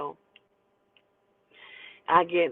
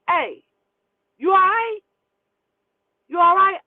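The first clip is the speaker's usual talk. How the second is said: raised, high-pitched driver's call; 11 semitones higher, 7 dB louder than usual. Retold or imitated. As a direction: call out to check on a passenger. Bus driver calls out to her.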